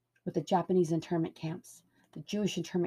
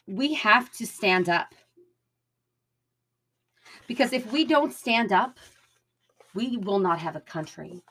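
loud soapbox ranting; 3 semitones higher, 7 dB louder than usual. Real, not acted. Rallying call to action.

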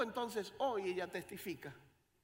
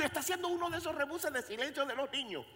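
ordinary talk, nothing marked

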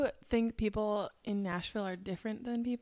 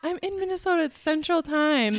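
higher, exasperated correction; exasperated reply; 8 semitones higher, 5 dB louder than usual. Retold or imitated. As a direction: set them straight, fed up. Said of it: "And I'm like".

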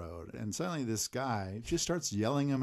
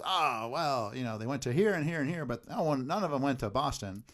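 chatty local; 6 semitones higher, 4 dB louder than usual. Retold or imitated. Acts out chatty local's breezy answer.

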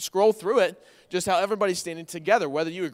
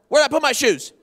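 louder and higher, irritated; mock snippy yell; 8 semitones higher, 8 dB louder, faster than usual. Husband snapping at his wife about lost shoes, loud. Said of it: "I'll be snippy with her".

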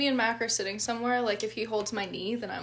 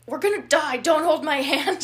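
loud, high panicky quote; hammed-up alarm at the wheel; mock panicked yell; 5 semitones higher, 9 dB louder than usual. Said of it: "he's like".

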